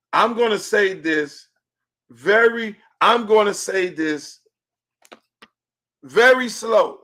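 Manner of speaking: slow, spaced-out words with long pauses; measured, emphatic slow announcement; deliberately slow. Real, not acted. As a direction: spell it out very slowly for emphasis. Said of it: "I'm gonna say this slow".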